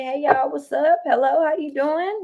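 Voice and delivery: playful imitated greeting at the door; mock polite greeting. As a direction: act out the polite hello she skipped. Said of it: "I ain't even say".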